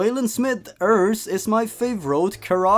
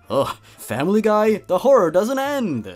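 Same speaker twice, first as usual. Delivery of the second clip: mock disgust; 6 semitones higher, 4 dB louder than usual. Mock outrage at Family Guy.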